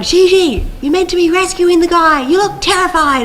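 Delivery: high, loud call over the noise; imitated shouted direction. Crew telling actress to rescue the guy, shouting high. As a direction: call out in exasperation to an actor mid-take.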